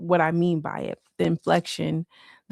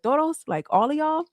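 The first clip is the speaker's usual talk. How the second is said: high question; 10 semitones higher than usual. Retold or imitated. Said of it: "I'm like".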